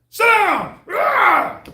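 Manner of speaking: high, loud shout; mock yelling mother. Imitating a mother screaming at her son. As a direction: bellow it like a furious mom. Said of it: "mother yelling at her son".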